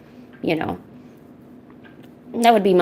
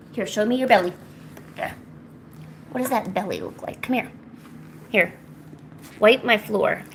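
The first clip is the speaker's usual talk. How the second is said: ordinary delivery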